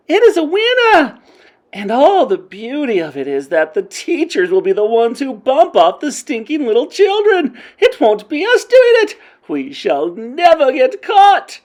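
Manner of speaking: high, loud cackling witch crowd; gleeful shouting. A narrator's reading. Witch crowd gloating over the plan, shrill gleeful shouts. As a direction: crow with wicked glee. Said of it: "clapping madly and shouting".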